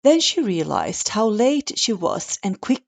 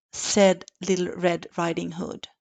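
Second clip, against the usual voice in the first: usual speaking voice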